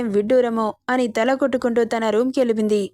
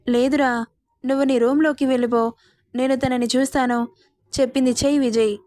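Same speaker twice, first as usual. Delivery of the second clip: ordinary delivery, nothing marked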